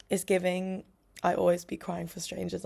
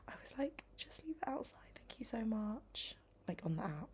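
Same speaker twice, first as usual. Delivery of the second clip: hushed whisper, much softer; 9 dB quieter than usual. Real, not acted. Vlogger whispering while the delivery knocks at her door. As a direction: keep it down so the courier can't hear.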